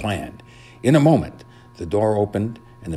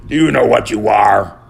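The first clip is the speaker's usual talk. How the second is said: loud, groggy dog owner; sleepy owner talking down to his dog; sleepy man; 3 semitones higher, 9 dB louder than usual. A narrator's reading. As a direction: scold the dog half-awake, loudly. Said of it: "the man sleepily asked the dog".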